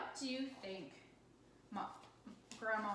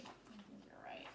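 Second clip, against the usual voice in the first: breathy whispered agreement; hushed aside to the student; 12 dB quieter than usual. Real, not acted. Teacher agrees softly.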